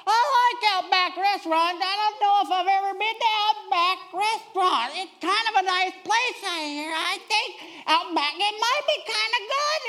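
mock teen dropping hints; hinting teenager. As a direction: fish for lunch without asking. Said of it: "Jordan goes like this".

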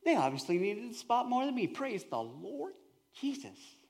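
mock serene surrender; calm prayer. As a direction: settle yourself and pray gently. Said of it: "I have to calm myself and center myself".

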